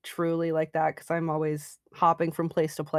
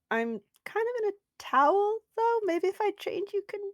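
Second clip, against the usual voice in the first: bashful character; 13 semitones higher than usual. Acted. Character voice, high.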